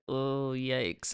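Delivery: low, soft aside; mock awkward unease. Dog sees owner crying, imitated awkward unease. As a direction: back away quietly, imitating an uncomfortable dog. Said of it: "And Alfie's like".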